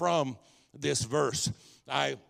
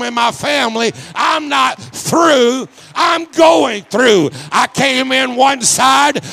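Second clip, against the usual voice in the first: impassioned pulpit cry, far higher and louder; preaching at full force; 9 semitones higher, 16 dB louder than usual. Real, not acted. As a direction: shout it to the whole congregation, stretching key words.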